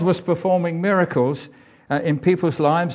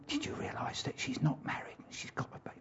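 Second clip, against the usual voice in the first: hushed whisper of scandal; gossip whisper; 20 dB quieter, faster than usual. Retold or imitated. A neighbour passes on scandal about an unwed mother in a whisper. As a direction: whisper it like village gossip behind a hand. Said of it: "I can remember when I was a child".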